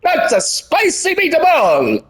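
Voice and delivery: high, slow cartoon Italian chef. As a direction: belt it like a hammy TV chef ad. Catchphrase for blood spatter.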